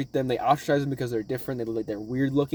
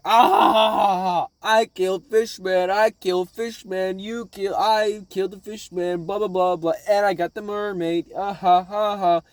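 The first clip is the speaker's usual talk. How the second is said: higher, drawn-out mock gloating; gloating villain; 4 semitones higher, slower than usual. Shooter bragging about killing Fishman, mocking gloat. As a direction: crow over the kill with fake laughs. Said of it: "Goober who shot him, is like".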